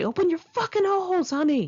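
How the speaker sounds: high crude mocking cook voice; shrill it out high and rude. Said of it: "he'd say".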